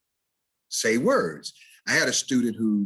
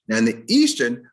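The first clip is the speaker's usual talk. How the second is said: ordinary talk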